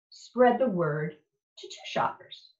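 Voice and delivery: plain reading voice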